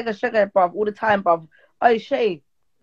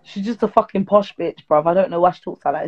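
ordinary talk, nothing marked

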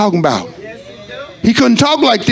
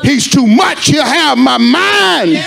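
rising, shouted preaching, slowing down; preaching at full force; 10 semitones higher than usual. Acted. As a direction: build the sermon into a cry.

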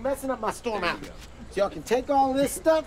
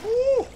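high loud whoop from the cold; cold shock whoop; 13 semitones higher, 4 dB louder than usual. Real, not acted. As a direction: whoop at the freezing water. Stepping into ice water.

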